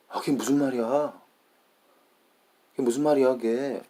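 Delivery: high, fast Korean conversational tune; Korean people's intonation. Shown as a demonstration. Speaker showing how Korean people talk, lively Korean intonation. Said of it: "This is the way Korean people talk".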